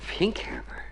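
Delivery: hushed, breathy whisper. Acted. Jim whispering his doubts to Marion, hushed. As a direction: keep it secret between the two of you.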